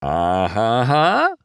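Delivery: slow, high pleased Italian chuckle; grinning chuckle. A narrator's reading. Luigi grinning at McQueen's new tires, pleased chuckle. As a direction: chuckle with a proud grin. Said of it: "Luigi said with a grin".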